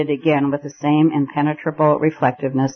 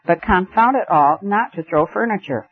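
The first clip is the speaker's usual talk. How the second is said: ordinary delivery, nothing marked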